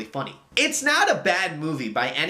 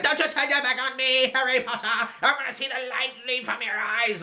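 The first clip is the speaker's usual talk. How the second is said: hammed-up villain, much higher, breaking into a laugh; goofy Voldemort; 10 semitones higher than usual. Reviewer mocks the film's Voldemort, goofy and laughing. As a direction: play a silly, over-the-top dark lord. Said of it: "I think he was very goofy".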